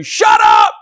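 sudden low, loud bark of shut up; shouted shut up. Pretend. Preacher shouting at a talker to shut up. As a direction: cut them off with a loud shout.

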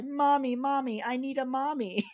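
high, plaintive storybook wail; crying little bird. A narrator's reading. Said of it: "he started to cry".

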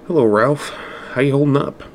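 a sly, amused hello to the man he hates; mock-friendly greeting with a smirk in it; hint of amusement. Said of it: "Patrick's voice held a hint of amusement".

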